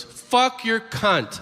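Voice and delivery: high, drawn-out blurted swearing; mock outburst. Kid with Tourette's in church, mock involuntary outburst.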